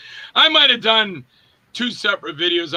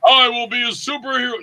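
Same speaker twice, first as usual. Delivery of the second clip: mock superhero; 6 semitones higher than usual. Actor pitching a superhero role to the director, hammed-up high proclamation. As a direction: declare it like a hammy comic-book hero.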